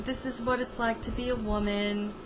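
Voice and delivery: put-on hushed, tender old-school mother; soothing sixties mother. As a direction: coo "This is what it's like to be a woman" tenderly and hushed. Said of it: "if this was a mother in the nineteen sixties".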